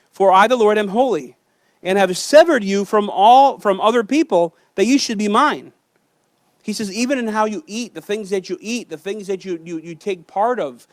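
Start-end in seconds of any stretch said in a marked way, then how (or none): 0.1-5.7 forceful, ringing preacher's proclamation; preaching the verse to the congregation, loud and forceful; loud scripture reading. Real, not acted.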